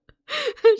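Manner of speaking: loud high laugh; a big squealing laugh; high burst of laughter. Real, not acted. Laughs at little sister joke.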